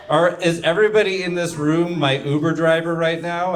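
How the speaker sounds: ordinary talk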